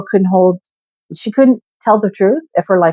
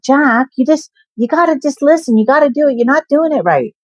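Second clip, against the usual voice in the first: much higher, impatient mock coworker voice; imitating the younger coworker; 9 semitones higher than usual. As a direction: mimic her bossy lecturing, pitched way up. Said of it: "And she's like".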